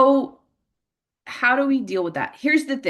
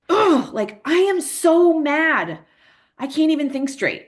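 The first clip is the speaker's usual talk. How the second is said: "Ugh" higher, then "I am so mad" slow and louder; mild modeled frustration; 8 semitones higher, 5 dB louder than usual. Shown as a demonstration. Parent modeling anger for a teen, slow mild frustration. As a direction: show frustration, but keep it in check. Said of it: "more intensely than I'm saying it right now".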